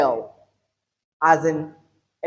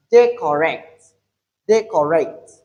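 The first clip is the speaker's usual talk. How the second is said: "day correct" with DAY punched, the rest falling away; stress on first syllable; 3 dB louder than usual. Shown as a demonstration. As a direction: hit DAY hard in "day correct". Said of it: "we have the stress on day".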